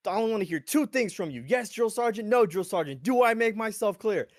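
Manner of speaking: loud, high barking drill sergeant; drill sergeant yell. Retold or imitated. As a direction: bark orders like a drill instructor. Drill sergeant yelling at recruits.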